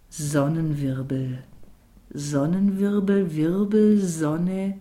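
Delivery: savouring each word, drawn out; rolling a word around. A narrator's reading. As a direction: turn the word over dreamily. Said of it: "ließ es das Wort als gelbes Rad im Kopf hin und her rollen". (das Wort genüsslich hin und her rollend)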